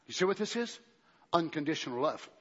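normal talking voice

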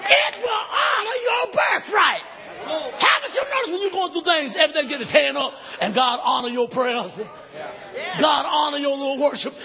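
impassioned shouted sermon, peaking high; full-force preaching. Acted. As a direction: rouse the congregation with each phrase.

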